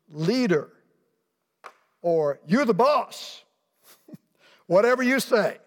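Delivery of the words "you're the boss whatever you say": higher, louder mock-grudging "you're the boss" with a laugh; grudging Peter. Peter humouring Jesus about the nets, mock-grudging and laughing. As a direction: toss it off like a fisherman humouring a landlubber.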